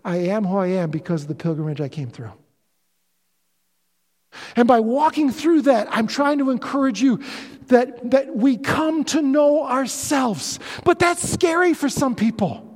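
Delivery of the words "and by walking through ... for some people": rising, louder preacher's build, driving each phrase home; impassioned sermon climax with pounding emphasis; preaching at full force. Acted. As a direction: preach it out to the congregation.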